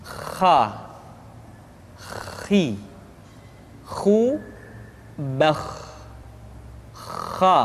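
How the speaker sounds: "Kha. Khi. Khu. Bakh." rasped deep in the throat like a snore. Shown as a demonstration. Teacher showing kha sounds like snoring. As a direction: let each "Kha" rattle like snoring. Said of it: "seperti orang yang tidur dalam keadaan mengorok".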